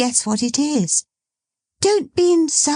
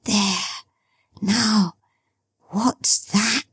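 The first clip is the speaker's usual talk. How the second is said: hushed, ending in a whisper; quiet as if something might overhear; whispered girlish question; speaking softly; 5 semitones higher, slower than usual. Acted. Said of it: "speaking very softly".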